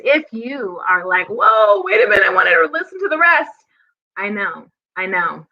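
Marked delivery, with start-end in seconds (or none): 1.3-3.5 higher, quick objection of a listener; mock protest. Host voicing a viewer who wanted more music, mock protest. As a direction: cut in like a let-down viewer, playfully.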